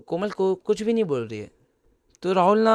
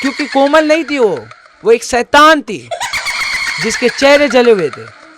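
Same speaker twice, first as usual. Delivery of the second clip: dramatic reveal shout; 6 semitones higher, 9 dB louder than usual. A narrator's reading. Horror story reveal of demon.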